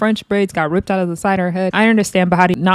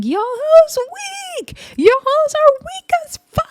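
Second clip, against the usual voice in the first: high, shrieking mock yell of angry drunk girls; mock drunken yell. Upset girls on the van yelling insults at rivals, high mock shriek. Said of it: "they're angry and they're yelling".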